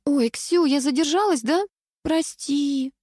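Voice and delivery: put-on high-pitched, girlish prattle of excuses; babbling apology. A narrator's reading. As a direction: babble a sugary sorry to a friend. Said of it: "следом залепетала Светка".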